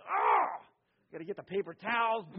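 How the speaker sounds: loud exasperated cry at spilled milk; mock irritated outburst. Parent yelling at the spilled milk, loud and exasperated. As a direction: blurt it out, fed up, half-mock. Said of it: "And we".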